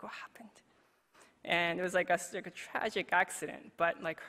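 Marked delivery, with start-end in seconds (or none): 0.0-0.5 much softer, gentle question; hushed question. Retold or imitated. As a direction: ask very softly. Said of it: "It's like".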